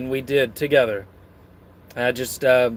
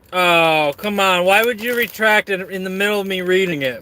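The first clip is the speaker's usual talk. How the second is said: ordinary delivery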